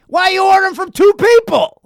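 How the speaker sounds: high, loud indignant shout; angry waiter. Retold or imitated. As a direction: demand it like he caught you cheating. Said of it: "He's like".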